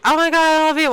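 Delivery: high-pitched mock fangirl gush; imitated fan gushing. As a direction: gush like a starstruck fan, playfully. Imitates the gushing fan she wasn't.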